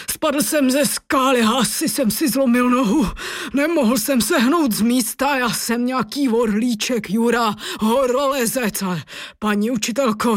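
wavering, put-on climber's voice, pitched a little up; rum-sipping injured climber. A narrator's reading. Rescued climber offering the teacher rum, wobbly character voice.

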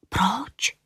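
soft whispered question; mysterious, rising whisper; secretive whisper. A narrator's reading. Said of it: "šeptala tajemně Julinka".

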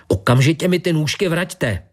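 high, indignant demand without much volume; mild shriek. A narrator's reading. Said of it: "zaječela". (mírné zaječení, vysoký hlas)

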